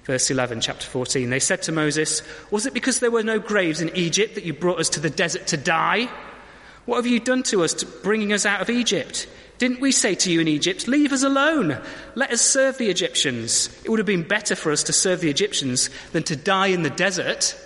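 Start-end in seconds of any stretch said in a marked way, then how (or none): 2.5-10.9 put-on whiny, higher grumpy-teen voice; grumpy teenager. Preacher voicing the Israelites as grumpy teenagers. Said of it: "Sort of grumpy teenager mode". 10.9-11.8 high, loud whiny plea; mock pleading cry. Israelites begging Moses, loud whiny plea. Quoted plea inside quote. 12.1-17.6 put-on whiny, higher grumpy-teen voice; grumpy teenager. Preacher voicing the Israelites as grumpy teenagers. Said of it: "Sort of grumpy teenager mode".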